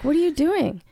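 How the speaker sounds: high, stunned question; incredulous. Retold or imitated. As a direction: call out in disbelief. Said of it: "I'm like".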